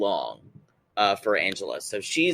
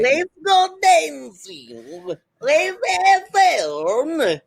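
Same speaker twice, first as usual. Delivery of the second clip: very high, stretched-out warped party call; hammed-up, slow, high-pitched foreign oddball; Florian voice; 4 dB louder, much slower than usual. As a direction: drag out every word in a high, strange put-on voice. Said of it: "Where does that Florian voice come from".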